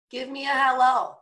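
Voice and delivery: normal talking voice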